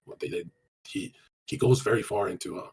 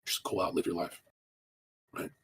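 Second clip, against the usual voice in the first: ordinary delivery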